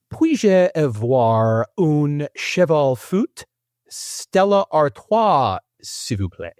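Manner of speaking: very slow, high, stretched-out French without accent; deliberately accentless French. Shown as a demonstration. Host reading translated beer order for quiz, deliberately accentless. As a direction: sound out each French word laboriously, no accent put on. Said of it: "with approximately zero accents".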